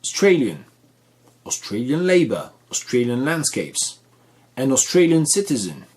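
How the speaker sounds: Australian punched on STRAY each time; careful English model, STRAY strong in Australian; second-syllable stress. Shown as a demonstration. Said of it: "c'est la deuxième".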